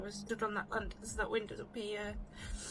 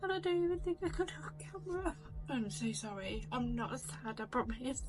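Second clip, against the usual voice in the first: tearful voice, pitched up; crying; 5 semitones higher than usual. Real, not acted. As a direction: talk through tears. Breaks down after the sighting.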